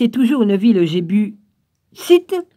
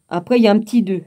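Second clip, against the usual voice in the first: usual speaking voice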